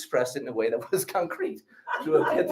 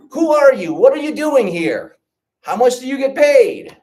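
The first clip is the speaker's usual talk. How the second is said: mock interrogation; 12 semitones higher, 8 dB louder than usual. Acted string of questions.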